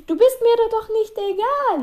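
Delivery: high, stretched-out teacher imitation; mock caring teacher. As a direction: drag out the last word in a sugary mocking voice. Mimics the teacher's caring line.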